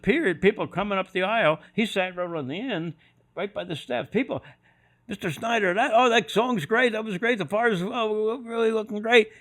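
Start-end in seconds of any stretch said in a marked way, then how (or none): 5.1-9.3 high, eager praise; gushing fans. Retold or imitated. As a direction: sound thrilled like a happy fan.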